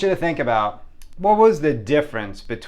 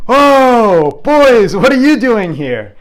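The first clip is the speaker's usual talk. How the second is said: high, loud cry of surprise; startled teacher; 10 semitones higher, 13 dB louder, much slower than usual. Retold or imitated. Teacher turning to find boys behind her, startled. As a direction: exclaim it big, stretching the words.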